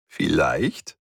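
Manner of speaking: coy, teasing lilt; mysterious smile. A narrator's reading. Efi dodges a question about her wish, mysterious. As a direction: let it curl upward, secretively. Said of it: "Efi lächelte geheimnisvoll".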